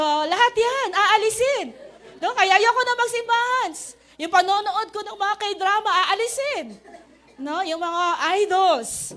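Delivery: mock complaining. Mocking complaints about giving things up.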